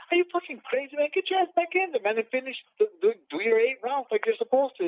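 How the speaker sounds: high-pitched incredulous telling-off at normal volume; retold exasperated scolding. Retells scolding his brother in disbelief.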